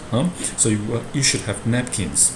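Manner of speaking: normal talking voice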